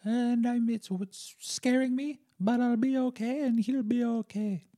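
high put-on voice of a mother calming herself; worried mom. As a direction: imitate a scared mom talking herself down. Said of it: "she's like".

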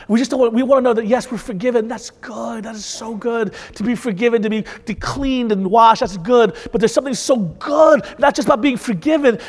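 ordinary talk, nothing marked

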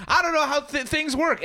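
high-pitched clueless infomercial idiot. Acted. Hapless infomercial idiot who can't work things, high clueless voice. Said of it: "it's one idiot who's like".

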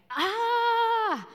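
high, drawn-out mock yell; imitated yelling. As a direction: imitate a long "aah" from across the room. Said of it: "I hear yelling".

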